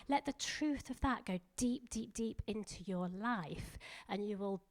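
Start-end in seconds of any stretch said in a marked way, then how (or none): none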